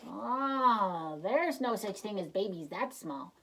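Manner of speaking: scoffing disbelief; disbelieving mock voice; doubtful scoff. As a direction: scoff like a sceptical listener. Skeptical listener voice.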